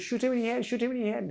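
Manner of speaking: high, lightly voiced order; mock the gunman's order, high; imitating attacker. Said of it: "another one says".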